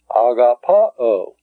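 Agapao said slowly with a falling close; slow Greek word. Shown as a demonstration. Teacher modeling the Greek word for students. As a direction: pronounce Agapao carefully. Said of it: "In the next word".

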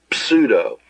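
"pseudo" breathed out, unvoiced and slow; whispered pseudo. Shown as a demonstration. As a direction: hiss "pseudo" slowly with no voice. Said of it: "We say".

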